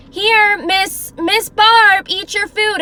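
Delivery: high, loud but unangry calling. Shown as a demonstration. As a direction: raise the voice to be heard, kindly enough. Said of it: "you can tell if someone's like".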